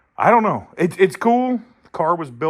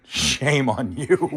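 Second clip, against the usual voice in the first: very slow, drawn-out mock reproach; mock scolding; much slower than usual. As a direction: stretch each word out in playful shaming. Mock scolding of whoever loses to it.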